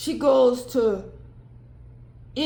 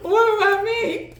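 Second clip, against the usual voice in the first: higher and louder, laughing through a mock plea; laughing mock whine; 8 semitones higher, 7 dB louder than usual. Divine masculine left out, laughing mock whine. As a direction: cackle out the complaint. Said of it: "He showed up like".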